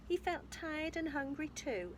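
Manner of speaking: plain reading voice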